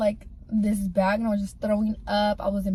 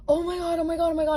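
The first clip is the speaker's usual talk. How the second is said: higher, frantic repeating of oh my God; panicked oh my God; 7 semitones higher, faster than usual. Retold or imitated. Boyfriend panicking at her split face, imitated panic. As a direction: imitate his panic as he sees the blood. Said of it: "he was like".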